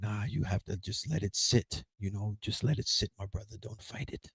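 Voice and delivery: low, soft, soothing shaman voice; calm shaman. Retold or imitated. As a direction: talk him down gently like a guide. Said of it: "And he was like".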